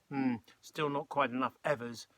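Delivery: ordinary delivery, nothing marked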